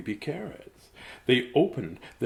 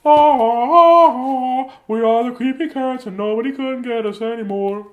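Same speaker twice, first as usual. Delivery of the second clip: much higher, loud mock-scary cry; playful shriek; 14 semitones higher, 15 dB louder than usual. Reader playfully roaring at the child, high and loud. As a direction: ham it up as a creepy creature.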